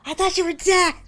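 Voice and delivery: high, rising startled voice; a sleepy horse voiced high and alarmed. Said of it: "Horse is like".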